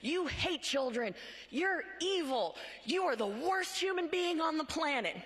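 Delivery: hammed-up yelling at a stranger; imitating an activist screaming accusations; retold screaming activist. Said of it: "she starts screaming".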